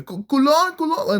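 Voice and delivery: very high falsetto mock yell; mock screaming. Two fighters yelling at each other on the lawn, high mock scream. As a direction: squeal it out like a cartoon scream. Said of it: "just screaming Culon at each other".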